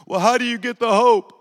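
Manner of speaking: loud, high plea for an answer; urgent imitated question. Grieving passenger asking preacher for hope, loud and urgent. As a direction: ask it as if desperate to know.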